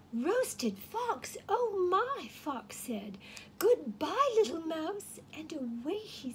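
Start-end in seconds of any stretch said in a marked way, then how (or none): 0.1-2.3 higher, louder alarmed gasp; a fox suddenly scared for his skin; startled fox. Acted. Fox frightened reaction. 3.6-5.2 louder, higher rushed farewell; hasty goodbye. Acted. Fox fleeing.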